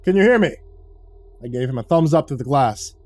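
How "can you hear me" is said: higher, louder call across the room. A narrator's reading. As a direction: call through the glass. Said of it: "Kaz called out".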